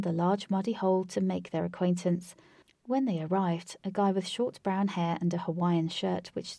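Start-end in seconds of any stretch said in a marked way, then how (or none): none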